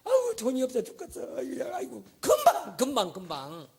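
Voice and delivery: high, put-on moaning complainer; whining believer. Believer moaning about money after hallelujah, whining high. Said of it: "할렐루야 해놓고".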